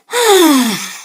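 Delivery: a long, weary exhaled sigh; uncle's sigh. Acted. Uncle giving up on the piano lesson, sighing. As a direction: sigh out your frustration. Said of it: "The uncle sighed".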